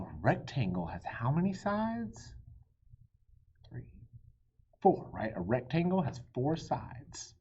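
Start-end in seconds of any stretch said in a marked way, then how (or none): none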